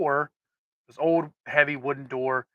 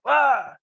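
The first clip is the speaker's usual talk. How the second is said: startled yelp; 10 semitones higher, 3 dB louder than usual. Retold or imitated. Both jump at toilet slam.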